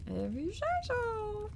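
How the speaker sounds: very high, soft and slow; squeaky voice. Pretend. Streamer repeating the line to the game in a tiny high voice. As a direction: say it again squeaky and small.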